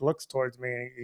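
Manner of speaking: usual speaking voice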